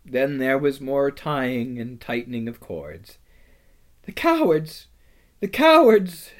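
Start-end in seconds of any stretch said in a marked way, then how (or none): 4.0-4.9 higher, tearful outcry; sobbing. A narrator's reading. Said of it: "sobbed Susan". 5.4-6.3 high, louder tearful cry; sobbing. A narrator's reading. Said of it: "sobbed Susan".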